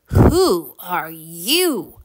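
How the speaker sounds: drawn-out, wary question; slow, emphatic boy's question to a stranger; startled question. A narrator's reading. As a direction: stretch each word out in astonishment. Boy startled by a stranger troll.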